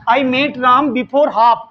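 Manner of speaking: each English word spaced out very slowly; deliberate classroom dictation pace. Shown as a demonstration. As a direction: read it out slowly for students to write down. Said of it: "teacher dictating example sentence".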